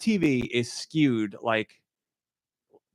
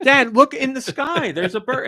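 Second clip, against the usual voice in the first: exasperated urging; 11 semitones higher, 4 dB louder than usual. Retold or imitated. Daughter pointing dad to the sky, mock-exasperated. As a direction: spell it out for dad, pitched up.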